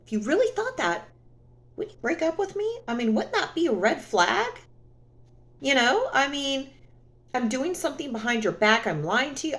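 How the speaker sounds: high, pointed mock-reasonable questioning; sarcastic retort. To cheating ex, a high mock-sweet sarcastic challenge. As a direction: lift the voice high and needle him with the logic. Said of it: "I'm like".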